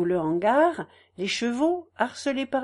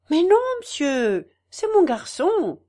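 higher and louder, proud; maternal pride; 7 semitones higher, 5 dB louder than usual. Acted. Mother proudly correcting the narrator, with pride. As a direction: declare him proudly as hers. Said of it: "avec un orgueil maternel".

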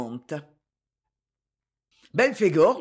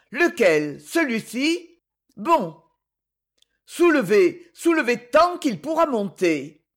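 louder, high commands; urgent shouting; 4 semitones higher, 3 dB louder than usual. A narrator's reading. Marion straining at the lever, urgent shouts. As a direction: shout as you heave. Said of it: "cria Marion".

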